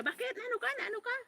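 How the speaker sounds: high, alarmed call without real volume; mock alarmed yell. Brother shouting over the phone, a mock high yell. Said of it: "nagsisigaw siya".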